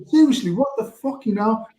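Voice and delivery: mock disbelief. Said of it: "and I was like".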